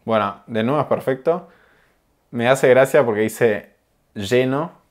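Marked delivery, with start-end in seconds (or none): 4.1-4.8 "lleno" with the ll as sh, "sheno"; sh sound in lleno. Shown as a demonstration. Teacher showing the Rioplatense "lleno" with an sh sound. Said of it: "con la sh".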